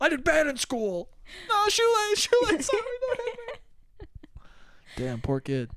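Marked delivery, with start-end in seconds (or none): 0.0-1.1 higher, small confessing voice; mock timid kid. Kid answering the mother. 1.5-4.1 much higher, hammed-up pleading kid; mock frightened plea.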